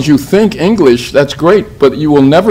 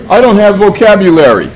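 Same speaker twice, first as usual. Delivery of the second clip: high, loud imitation of a complaining learner; mock student excuse; 7 semitones higher, 5 dB louder than usual. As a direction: parody a student making excuses. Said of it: "the other thing I hear".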